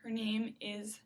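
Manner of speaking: ordinary talk, nothing marked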